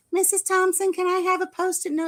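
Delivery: high, light voice of a pleading student; student asking. Retold or imitated. Student pleading with the school secretary, mock high voice. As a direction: play the kid asking teacher for a Post-it.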